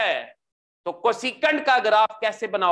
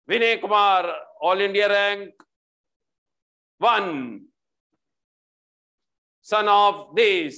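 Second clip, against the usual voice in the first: mock stage announcer; much slower than usual. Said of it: "आवाज दी जाती है".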